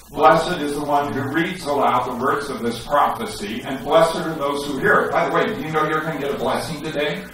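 ordinary talk, nothing marked

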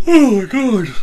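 high, loud words through a yawn. Real, not acted. Player apologises for a tiny yawn, yawning. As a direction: let a yawn take over the words.

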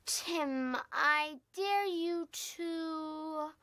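very slow, words held long; slow, teasing game announcement; drawn-out suspense. Acted. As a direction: stretch the dare out before revealing it. Second drawn-out dare.

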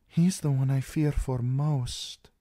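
high, slow confiding princess. A narrator's reading. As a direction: lean in and confide, worried.

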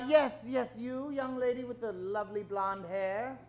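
usual speaking voice

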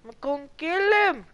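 pumped to take the kill; excited shout. Real, not acted. Charging an enemy player.

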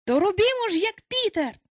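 put-on high, rousing boys' cry; rally the others with a high-pitched shout; excited exclamation. A narrator's reading. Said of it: "А тоді вигукнули". (запальний високий вигук)

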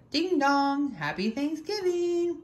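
high singsong doorbell, then slow greeting; cheery doorstep greeting. A narrator's reading. Disguised Turkey at the farmer's door, high singsong greeting. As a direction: sing out high, stretching it.

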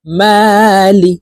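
মালি read very slowly, the মা drawn out; slow stretched reading. Shown as a demonstration. Teacher reading মালি slowly for students to copy. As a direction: lengthen the a in মালি. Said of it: "আমার সঙ্গে বলে শিখে নিন".

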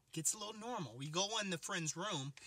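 ordinary talk

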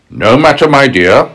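smooth crafty wolf. Acted. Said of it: "said the wolf".

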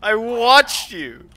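loud accusing yell; angry shout. Real, not acted. Heated argument about flashlight.

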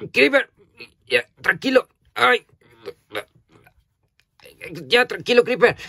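high, loud, alarmed cries to calm him down; alarmed shouting. Real, not acted. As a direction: shout at him to settle down, startled. Calming a rowdy toy Creeper. (gritos alarmados para calmar al Creeper)

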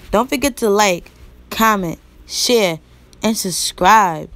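loud, stretched sign-off pitch to the viewers; loud drawn-out hype outro; hyped vlog outro. Acted. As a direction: sell the subscribe like a hype host. Vlog outro call to action.

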